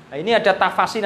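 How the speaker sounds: usual speaking voice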